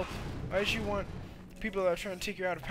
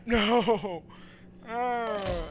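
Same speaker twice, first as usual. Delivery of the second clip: ordinary talk, nothing marked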